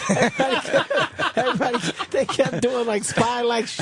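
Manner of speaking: high, laughing, half-shouted punchline; mock spy talk breaking into laughter; laughing spy mockery. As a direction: giggle through it while mocking the sneaking. Mocking spies sneaking around.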